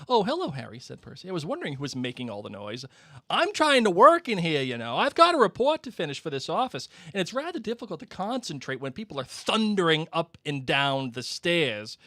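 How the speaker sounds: high, loud and fussy prig; pompous Percy. Acted. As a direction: play the stuck-up older brother annoyed at noise. Reads Percy aloud.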